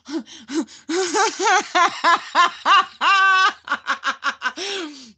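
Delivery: high, loud burst of laughter. Real, not acted. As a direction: laugh out hard.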